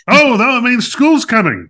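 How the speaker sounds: high, loud mock alarm; mock dread. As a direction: wail it in playful dread. Dreading the school year.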